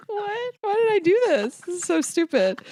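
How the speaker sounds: high, tearful half-laugh; fighting back happy tears; choked-up laughing cry. Real, not acted. Reading a birthday card, choking up with tears. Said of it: "I'm gonna cry reading this".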